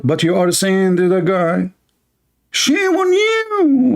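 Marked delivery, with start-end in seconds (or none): 2.5-3.9 high, slow sing-song imitation of a scold; mocking high quote. Mocking the scholar's rebuke in a high, drawn-out voice. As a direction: stretch it out like a prissy scold.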